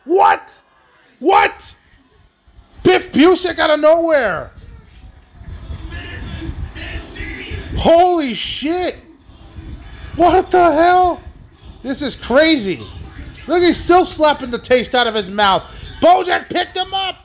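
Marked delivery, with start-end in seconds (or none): 0.0-11.2 high-pitched, frenzied commentary; wild disbelief at ringside; astonished shouting. Real, not acted. Lariat and shock kickout. 16.0-17.2 high-pitched climactic match call; real ringside frenzy; excited shouting. Real, not acted. Calling the finishing power bomb.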